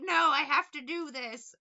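mock drunken insistence; drunk boss. Boss refusing help while crawling, mock drunk. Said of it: "And he's like".

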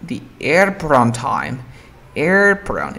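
airport on as "airpor-on"; "airport on" joined as one word; linked reduction. Shown as a demonstration. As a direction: drop the T in "airport on". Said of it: "enfócate en la R T O".

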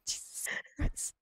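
hushed whispered yelp; whisper-scream the curse. Retold or imitated. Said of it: "I was like".

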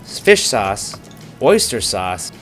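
ordinary talk

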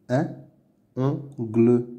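"Un ongle" as "un", "on", "gle", no liaison; syllable by syllable. Shown as a demonstration. Teacher sounding out words to read, "Un ongle" in separate syllables. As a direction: pause between the pieces of "Un ongle". Reading drill, split then blended.

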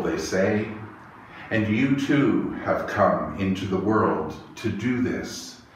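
usual speaking voice

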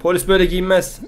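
loud, high cries of pain and protest; pained yelling. Acted. Husband shouting at the fake cop cuffing him, pained and loud. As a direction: yell as the cuffs twist the wrist. Husband arrested, wrist hurting.

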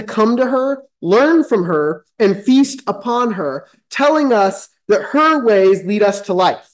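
measured preacher's reading of scripture; steady sermon cadence. Acted. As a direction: read it out like a pulpit preacher.

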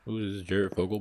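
ordinary talk, nothing marked

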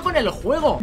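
ordinary talk, nothing marked

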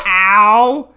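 sudden loud ow; yelp of pain. Real, not acted. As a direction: yelp when it hurts. Dog pulled headphones.

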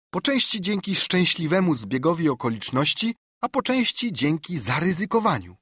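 lightly voiced boy standing firm; earnest boy. A narrator's reading. Jim refusing the doctor, lightly voiced earnest boy.

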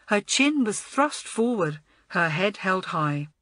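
ordinary delivery

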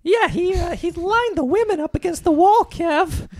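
high, loud creepy clown host voice; shrill murdering clown version of Leno; Jay Leno. Acted. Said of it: "murdering clown, Jay Leno".

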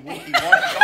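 the words collapse into a laugh. Real, not acted. Dad reading to the kids, losing it laughing.

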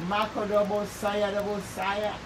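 ordinary delivery

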